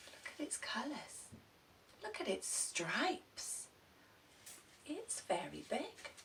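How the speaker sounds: soft awed whisper; whispered amazement; hushed wonder. Acted. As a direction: breathe it out in wonder at the feather.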